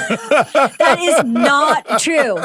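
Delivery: slow, loud, laughing disbelief; laughing protest. Real, not acted. Host laughing at the guest's joke, slow and loud. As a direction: drag it out through a laugh.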